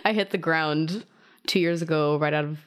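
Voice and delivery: usual speaking voice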